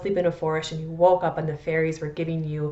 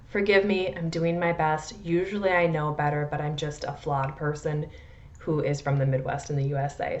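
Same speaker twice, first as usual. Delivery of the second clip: normal talking voice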